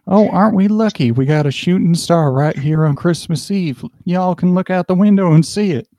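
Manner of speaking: high-pitched American driver, y'all and all; American accent. Acted. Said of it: "speak with an American accent".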